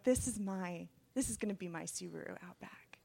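soft thought sinking into a whisper; hushed awe. Retold or imitated. Daughter sees the car glowing in streetlight, hushed awe. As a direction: let it fade down to a private whisper.

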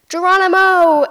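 loud, high, joyful shout; excited yell. Retold or imitated. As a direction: yell it as you rocket down the hill. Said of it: "he yells".

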